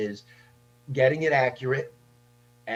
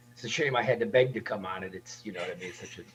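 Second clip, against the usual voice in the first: deadpan mock complaint, trailing off softer; sarcastic; 5 semitones lower, 3 dB quieter, faster than usual. Real, not acted. Guest teasing the host about being invited on, sarcastic. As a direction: feign grudging thanks, tongue in cheek. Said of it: "He's being sarcastic".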